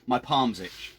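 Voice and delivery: usual speaking voice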